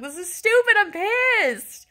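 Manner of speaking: high, loud angry outburst; mad ranting. Retold or imitated. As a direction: reenact a furious rant. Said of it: "screaming and mad and pissed off".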